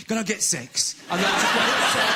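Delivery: mock prim request.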